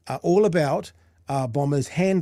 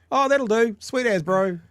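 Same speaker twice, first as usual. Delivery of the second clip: put-on high, laid-back broad kiwi accent; easygoing broad kiwi drawl, pitched up; 9 semitones higher, 5 dB louder, slower than usual. Imitating a relaxed kiwi official.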